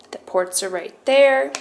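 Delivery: ordinary delivery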